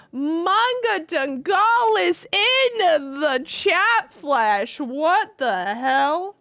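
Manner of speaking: slow, drawn-out, swooping astonishment; amazed excitement. Real, not acted. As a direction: announce a surprise guest in disbelief. Amazed by viewer's arrival.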